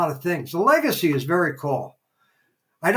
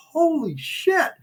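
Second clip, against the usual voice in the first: stunned disbelief; 11 semitones higher, slower than usual. Retold or imitated. Stunned reaction to the story.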